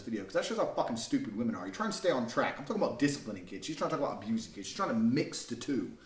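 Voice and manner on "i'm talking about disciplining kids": usual speaking voice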